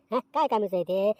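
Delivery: ordinary talk, nothing marked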